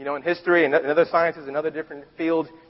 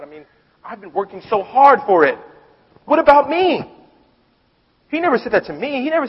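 jealous complaint; 6 semitones higher, 4 dB louder than usual. Retold or imitated. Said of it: "I can feel confused".

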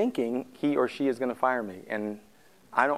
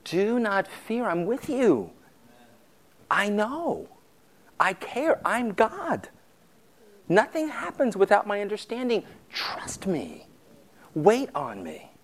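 higher, gentle voice of God; voicing God; 6 semitones higher, slower than usual. Pastor speaking as God to the frightened, gentle and higher. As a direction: speak as God comforting his people. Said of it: "journey with me from Isaiah".

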